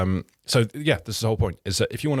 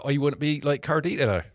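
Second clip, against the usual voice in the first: mock West Country accent; 5 semitones higher than usual. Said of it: "I was gonna go big Gloucestershire and then a bit west further".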